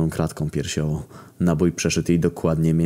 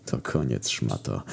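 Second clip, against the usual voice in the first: much lower, softer smug taunt; smug grin; 8 semitones lower, 8 dB quieter, slower than usual. A narrator's reading. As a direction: gloat quietly through a grin. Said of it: "uśmiechnął się ponownie".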